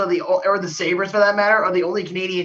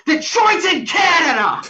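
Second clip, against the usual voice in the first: higher, louder jeering cry; mock yell; 9 semitones higher, 3 dB louder than usual. Rival fan jeering a Wings chant, loud and high. Imagined heckler's punchline yell.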